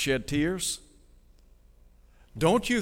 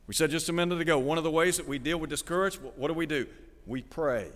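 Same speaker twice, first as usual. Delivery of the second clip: ordinary talk, nothing marked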